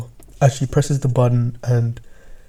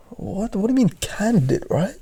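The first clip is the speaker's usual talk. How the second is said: confused; 8 semitones higher than usual. Acted. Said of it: "you're confused".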